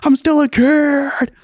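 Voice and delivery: very high, drawn-out mock wail; freaking-out sixteen-year-old. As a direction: wail it like an overwhelmed teenager.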